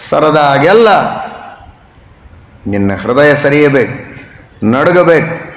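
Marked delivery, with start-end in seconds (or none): none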